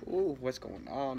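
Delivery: ordinary delivery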